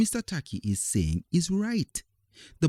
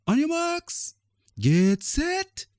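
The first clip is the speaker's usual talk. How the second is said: shouting games teacher; slower than usual. Acted. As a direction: call the start across the field. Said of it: "shouts the games teacher".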